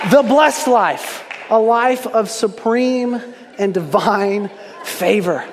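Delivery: The blessed life, drawn out slowly; preacher's slow punchline; slow emphatic refrain. Acted. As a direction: land the refrain slowly and deliberately. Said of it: "sermon refrain, slowed".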